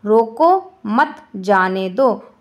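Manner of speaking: slow "रोको मत जाने दो", broken after "रोको" and after "मत"; pauses for meaning. Shown as a demonstration. Teacher showing the first meaning, "रोको मत जाने दो" slowly broken by pauses. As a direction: split "रोको", "मत", "जाने दो" with deliberate gaps. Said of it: "तो पहला अर्थ क्या होता है".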